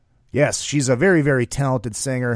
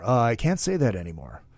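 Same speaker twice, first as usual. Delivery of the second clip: lower, softer grumbling repeat; low Gene voice; 5 semitones lower, 7 dB quieter than usual. Retold or imitated. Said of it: "Gene, you can't say that".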